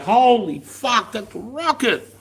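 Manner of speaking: high, drawn-out astonished reaction; acted shock at a sudden blast; stunned realization. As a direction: stretch it out slowly in high-pitched disbelief. Reacting to a rocket exploding nearby.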